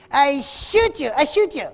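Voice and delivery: loud, high-pitched mock threat; threatening gunman. Gunman at roadblock threatening driver through window, mock threatening yell. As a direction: play an armed man yelling a threat. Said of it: "sticks his gun in the window and says".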